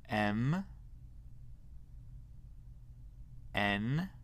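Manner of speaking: isolated letters, each drawn out with a rising end; teacher modelling the alphabet, rising on each letter; letter-by-letter model. Shown as a demonstration. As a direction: say each English letter alone, tune lifting for repetition. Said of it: "Vous allez répéter après moi pour chaque lettre".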